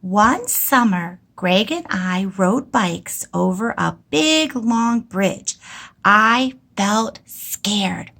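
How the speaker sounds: slow, careful rereading of the page; slow reading aloud. Shown as a demonstration. Teacher rereading her story slowly to the class. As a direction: read it back slowly, word by word. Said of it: "let's reread what Missus Ulm wrote".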